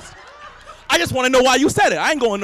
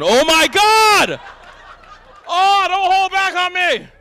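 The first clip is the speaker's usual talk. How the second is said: slow, loud shocked outcry; stunned exclamation; real surprise; 6 semitones higher, 4 dB louder, slower than usual. Real, not acted. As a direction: draw out the shock. Reacting to heckler.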